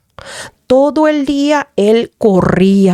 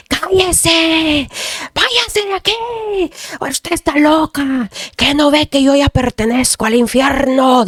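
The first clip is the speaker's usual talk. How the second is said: high, drawn-out demonic cries in a changed voice; possessed son's yelling; 6 semitones higher, slower than usual. Retold or imitated. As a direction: imitate a possessed son ordering her out, stretching the words. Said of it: "cuando él gritaba así". (imitando gritos de endemoniado, agudos y alargados)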